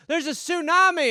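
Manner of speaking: high, alarmed mock shout; hammed-up tsunami alarm; mock warning. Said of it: "someone was like".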